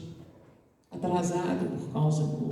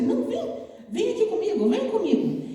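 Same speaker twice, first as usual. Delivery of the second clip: put-on much higher, playful child with a laugh; coaxing little girl; 11 semitones higher than usual. The little girl coaxes the student to follow her, high and playful. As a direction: tug him along like a playful child.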